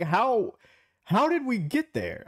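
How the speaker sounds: high-pitched bewildered question; incredulous, pitched well up; baffled. Real, not acted. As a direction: ask it in utter disbelief. Voicing bafflement at the mix-up.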